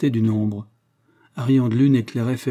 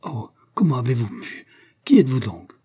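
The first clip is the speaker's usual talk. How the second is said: hissing voice. A narrator's reading. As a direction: spit the words through the teeth. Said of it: "D'une voix sifflante".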